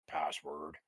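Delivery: low, guttural one-word demand; guttural doorkeeper. Acted. Doorkeeper demands the word through a slat, low and guttural. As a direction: growl it low from behind a door slat. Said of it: "guttural voice that just echoes one word".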